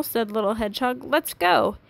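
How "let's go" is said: high-pitched, eager call to set off; urgent rallying call. A narrator's reading. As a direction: spur a friend into action. Hedgehog sets off to rescue.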